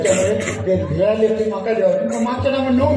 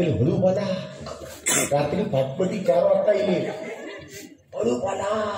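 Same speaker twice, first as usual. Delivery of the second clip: hushed; 2 semitones lower, 7 dB quieter than usual. Retold or imitated. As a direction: imitate the wife shushing. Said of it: "वहिनी सांगते". (वहिनी पाहुण्याला गप्प करते, हळू आवाजात)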